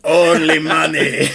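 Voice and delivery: slow, alien-trader voice with a laugh; hammed-up Watto voice; Watto impression. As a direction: growl it out like the greedy Star Wars junk dealer. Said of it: "my Watto impression".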